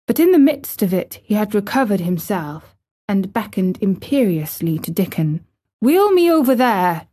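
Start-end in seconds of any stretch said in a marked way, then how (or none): 5.8-7.1 mildly imperious order; raised, firm voice of command. A narrator's reading. Boy orders Dickon to push his chair, commanding. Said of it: "he commanded".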